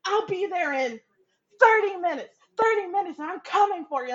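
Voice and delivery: high, loud menacing taunt; hammed-up loud threat; mock threat. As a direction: threaten him theatrically. Brother threatening.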